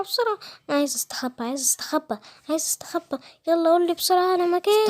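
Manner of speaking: high, fast, breathless begging; a hen pleading with the king to hide her; frightened chicken begging the lion for help. Acted.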